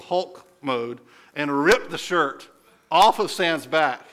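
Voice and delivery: ordinary talk, nothing marked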